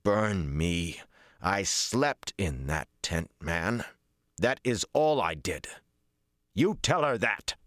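indignant protest, rising in pitch; irritated denial building to an order; mild snarl. A narrator's reading. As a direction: insist on innocence with a touch of snarl. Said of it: "It stayed in his voice".

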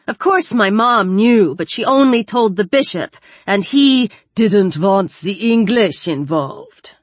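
put-on slow, drawling broad German accent. A narrator's reading. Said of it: "she drawled in a broad German accent".

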